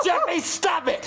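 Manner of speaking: high, slow, drawn-out yell; exasperated shout. Acted. Dad yelling over screams, exasperated shout. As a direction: stretch the furious order out.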